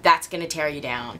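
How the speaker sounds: ordinary talk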